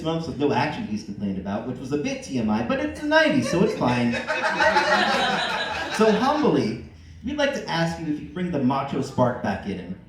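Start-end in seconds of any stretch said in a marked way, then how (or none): 4.2-5.9 giggling uncontrollably; breaking into laughter; high laughing fit. Real, not acted. Reader cracks up.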